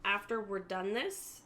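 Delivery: usual speaking voice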